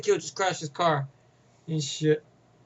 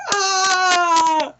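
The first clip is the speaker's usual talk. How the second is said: long, loud, high mock scream; imitated scream; 11 semitones higher, 6 dB louder than usual. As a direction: scream "aah" like a man hit on the head. Imitating man hit on head.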